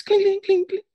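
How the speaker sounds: high tinkling "clink" noises; ringing little bell imitation; imitated chimes. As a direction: mimic crystal door chimes in a high pitch. Imitates door chimes as described.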